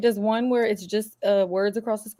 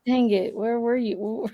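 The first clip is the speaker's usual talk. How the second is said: normal talking voice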